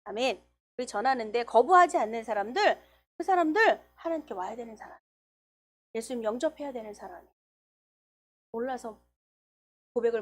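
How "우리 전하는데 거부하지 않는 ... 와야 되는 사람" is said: ordinary talk, nothing marked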